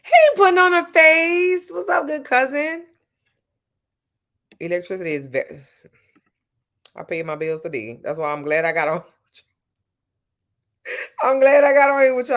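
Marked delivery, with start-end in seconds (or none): 0.0-2.9 high, loud, put-on mocking voice; mock greeting. Mocking the man in the article, high put-on voice. 11.2-12.4 high and loud through the laughter; can barely get the words out for laughing. Real, not acted. Thanking her chat while laughing hard.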